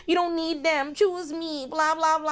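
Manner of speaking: high whiny mock plea; mocking Antoinette. Recapper mimicking Antoinette begging Louis, high and whiny. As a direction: pitch it up, pleading like the clingy side piece. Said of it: "being like".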